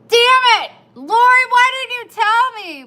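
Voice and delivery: loud, high, annoyed shout at herself and Lori; frustrated outburst. Retold or imitated. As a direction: blow up at Lori for not warning you. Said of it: "I was like".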